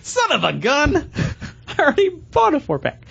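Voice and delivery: high-pitched mock exasperation; retold dismayed realization. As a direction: act out catching your own silly mistake. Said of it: "and I'm like".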